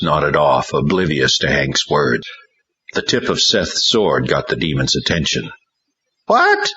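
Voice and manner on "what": shrill high screech of a demon. A narrator's reading. Demon screeching at the sword tip. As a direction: screech it like a startled spirit. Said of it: "it screeched".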